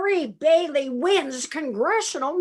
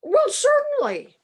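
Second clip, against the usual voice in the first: proud high reply; 10 semitones higher, 3 dB louder than usual. Acted. Billy proud, pitch jumps up.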